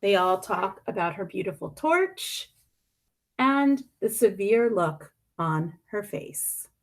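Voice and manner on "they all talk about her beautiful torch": plain reading voice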